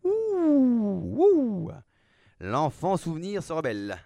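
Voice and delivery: high, loud mock-scared hooting; theatrical mockery. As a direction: taunt him in a high put-on voice. Villain mocks the rebelling hero. (moquerie aiguë et théâtrale)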